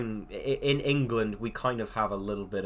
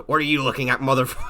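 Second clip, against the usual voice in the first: tough menace; 2 semitones higher, 8 dB louder, much faster than usual. Retold or imitated. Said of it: "versus Jaws".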